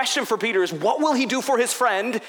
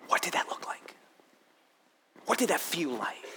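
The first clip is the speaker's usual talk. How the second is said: whispered, almost no voice; hushed whisper; 5 semitones lower, 9 dB quieter than usual. Real, not acted. Preacher whispers as Jesus meets Peter's eyes, hushed. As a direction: drop to a breath for the moment Jesus looks at him. Pastor wondering aloud, hushed.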